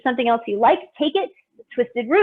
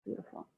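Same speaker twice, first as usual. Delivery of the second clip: dramatic sigh; 21 dB quieter than usual. Shown as a demonstration. Teacher sighs with the class.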